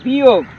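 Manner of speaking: वियोग as vi-yog, never vi-jog; example word, stressed. Shown as a demonstration. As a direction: say वियोग loud, with ya in the middle. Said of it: "ये अंतर में है".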